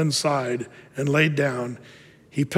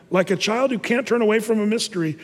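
normal talking voice

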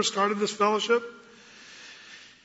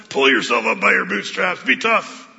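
gruff mock scold, louder; tough-love attitude; 5 dB louder than usual. Pastor mocking Christians who scold the hurting, gruff. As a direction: sneer it like a drill sergeant. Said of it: "we have kind of this attitude".

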